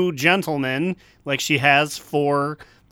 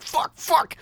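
panicked developers; 8 semitones higher, 4 dB louder than usual. Retold or imitated. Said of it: "so they're like".

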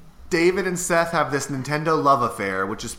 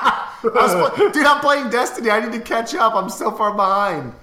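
high strained voice, pitch pushed way up and loud; desperate high voice; 10 semitones higher, 6 dB louder than usual. Real, not acted. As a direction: blurt it out, high and desperate with a laugh. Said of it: "Why did I change my voice like that?".

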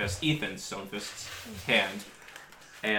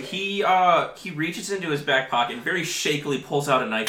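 ordinary talk

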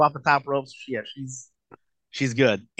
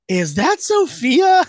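high, loud, drawn-out excited question; excited retold question; 12 semitones higher, 7 dB louder, much slower than usual. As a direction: ask it in a high, amazed squeal, stretched out.

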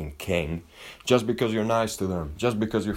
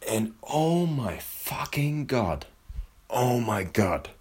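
awed moan; 5 semitones higher, much slower than usual. Real, not acted. Savoring the steak.

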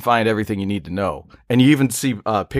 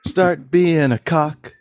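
high slow mock voice; 6 semitones higher, much slower than usual. Mock sing-song insult bit.

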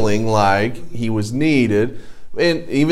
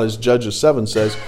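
ordinary delivery

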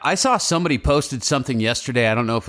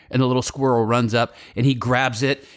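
usual speaking voice

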